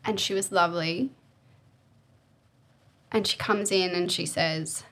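ordinary talk, nothing marked

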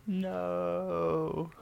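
long, high, drawn-out no; dismayed reaction. Real, not acted. Co-host hearing about the crumpled bagpipe case, dismayed.

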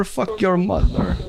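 "fuck your mother" pitched high and drawn out slowly; mock scam-center scream. Imitating a scammer screaming at callers, high and slow "fuck your mother".

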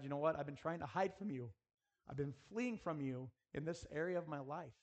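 ordinary talk

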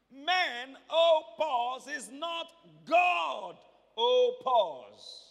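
"Men, oh, pause" in slow, loud, separate beats; booming, spaced-out wordplay on "pause"; split-word emphasis. Shown as a demonstration. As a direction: stretch "God" long and loud, then "oh, pause". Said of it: "Separate it".

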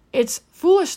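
"foolish" lifted high and loud; emphatic. Real, not acted. Preacher warning her listeners, "foolish" punched high and loud. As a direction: lean hard into "foolish".

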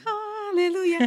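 high, loud and drawn out; joyful hallelujah. Retold or imitated. Rejoicing when a paying student answers her prayer. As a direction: shout praise, slowly.

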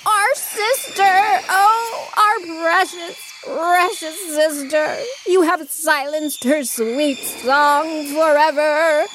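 high, slow hammed-up wailing; mock-tearful monkey lament; shrieking and crying. A narrator's reading. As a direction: bawl it out in theatrical grief. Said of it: "shrieking and crying".

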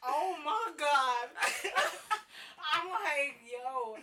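loud, slow embarrassed laughing. Real, not acted. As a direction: laugh out, mortified.

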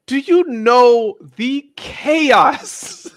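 slow, high, loud, laughing question; incredulous laughing to the listeners. Real, not acted. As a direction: laugh through the punchline.

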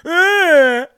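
high, loud mock wail; mock crybaby wail. Host mimics crying viewers on their couch, loud mock wail. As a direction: wail like a sulking keyboard warrior.

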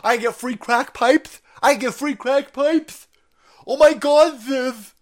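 high, squealing mock leftist gushing. Mocking leftists gushing over free crack pipes, high squeal. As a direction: shriek it like an over-thrilled caricature. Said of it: "these leftists are like".

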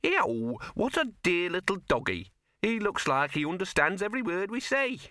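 high, prim, cooing woman's voice; simpering lady. Retold or imitated. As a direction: coo it like a fussy lady to a lapdog.

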